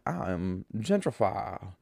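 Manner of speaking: put-on slow, stretched old Southern gentleman; lightly hammed-up old Southern drawl; old Southern accent. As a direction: savor each word like a genteel planter. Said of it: "He's like".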